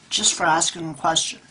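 ordinary talk, nothing marked

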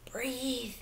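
quiet pleading thought; soft inner thought. Acted. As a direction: breathe it gently under the voice. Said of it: "thought Edward".

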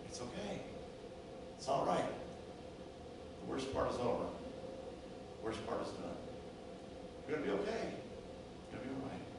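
very soft, hushed reassurance; tender near-whisper after the pain; slow, calm soothing. Acted. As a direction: comfort them gently, with pauses between phrases. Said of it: "Speak slowly and calmly".

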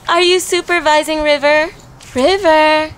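put-on high, excited cooing at a baby; baby talk. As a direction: gasp and coo it playfully.

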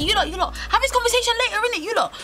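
high, hurried mock panic; a friend flapping to break it up; panicky friend. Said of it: "he was panicking".

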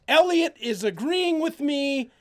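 usual speaking voice

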